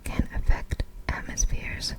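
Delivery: ordinary delivery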